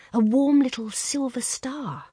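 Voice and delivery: ordinary delivery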